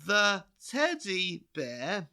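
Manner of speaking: high, very slow, stilted reading; mock clumsy storytime read; halting reading aloud. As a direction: read it like a child sounding out words. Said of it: "she was just like".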